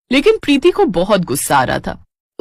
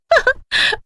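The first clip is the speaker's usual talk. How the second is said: gleeful wicked giggle. Acted. Wicked laugh after prank.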